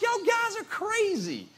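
very high, loud incredulous protest, easing down at the end; disbelieving Thomas. Acted. Thomas answering the disciples' claim, hammed-up disbelief. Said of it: "Thomas still didn't believe".

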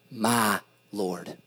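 My Lord spoken low and soft, slow and weighty; reverent stress on My Lord. Shown as a demonstration. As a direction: lay all the weight on My Lord. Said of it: "these two words".

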